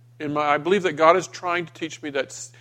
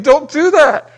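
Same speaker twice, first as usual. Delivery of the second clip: high, loud pleading cry; father crying out to stop his son, high and loud; urgent plea; 10 semitones higher, 12 dB louder, slower than usual. Retold or imitated. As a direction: stop him with a sharp desperate plea.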